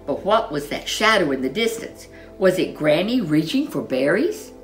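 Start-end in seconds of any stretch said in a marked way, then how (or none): none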